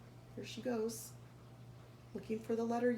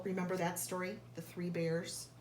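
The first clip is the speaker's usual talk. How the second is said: ordinary delivery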